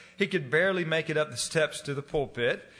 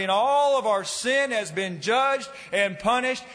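raised, fervent preaching voice; 6 semitones higher than usual. Acted. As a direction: preach it with building passion. Preaching at full force.

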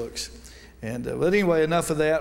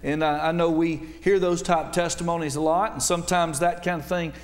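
preaching; 3 semitones higher than usual. Acted. Baptist preacher opening his sermon to the church at full preaching volume. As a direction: lift it up and preach with force.

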